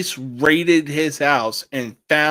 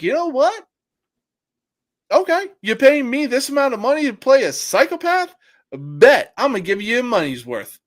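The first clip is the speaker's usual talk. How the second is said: higher-pitched cocky acceptance; game-for-it actor; 6 semitones higher, faster than usual. Retold or imitated. Imagined actor accepting a paid psychopath role, cocky and higher. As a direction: take the deal with brisk, higher-pitched swagger. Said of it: "it was like".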